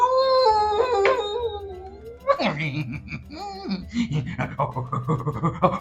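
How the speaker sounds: high, sneaky villain laugh; wolf gloating behind the door, sly laugh; sly wolf chuckle. Acted. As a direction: let a crafty chuckle slip out.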